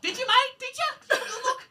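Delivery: high-pitched mocking disbelief; squeaky, doubting interrogation; sceptical needling. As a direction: press them with incredulous little questions. Sceptical needling of imagined voter.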